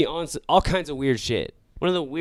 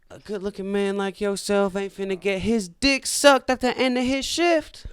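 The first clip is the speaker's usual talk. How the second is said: much higher, coaxing put-on woman's voice; flirty passenger voice; 8 semitones higher than usual. Passenger fishing for a hookup, high coaxing voice. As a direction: coax him in a high sing-song voice.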